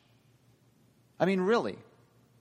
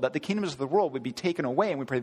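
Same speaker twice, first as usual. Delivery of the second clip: ordinary talk, nothing marked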